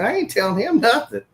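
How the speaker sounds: high, quick balking protest; balking refusal. Retold or imitated. Strip club DJ balks at bartender's order, high quick refusal.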